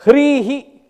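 loud, high-pitched recited term; emphatic recitation. Shown as a demonstration. Teacher reciting a Sanskrit term to students, emphatic recitation. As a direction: call the term out firmly.

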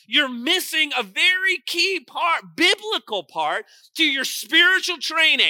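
high, driving pulpit voice; full-force preaching. Real, not acted. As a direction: build insistently on each repeated phrase. Preaches at full force, insistent repetition.

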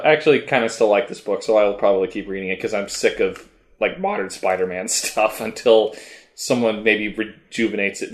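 usual speaking voice

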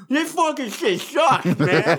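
talking through a mouthful; slow, words around a full mouth. Shown as a demonstration. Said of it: "talk with my mouth full then".

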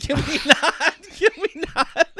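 high, pleading can we not; laughing protest. Real, not acted. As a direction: beg them to stop, giggling.

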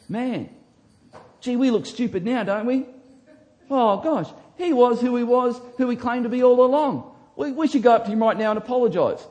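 panicked dawning of being wrong; flustered realisation. Pretend. Imagined open-minded Pharisee realising his mistake, flustered. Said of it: "You would go".

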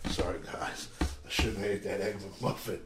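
sheepish umpire. Retold or imitated. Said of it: "he came back like".

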